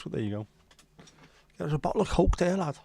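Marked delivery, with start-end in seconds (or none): 1.6-2.8 higher Scouse lad voice, pitched well up; cheeky Scouse bar call; Scouse accent. Acted. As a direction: call it out like a Liverpool barman. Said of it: "That happens occasionally with me".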